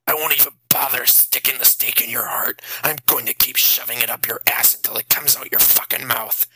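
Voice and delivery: hissed, whispered threat; a father whispering revenge at the vampire; menacing whisper. A narrator's reading. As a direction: whisper the threat through clenched rage.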